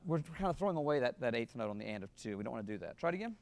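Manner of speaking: usual speaking voice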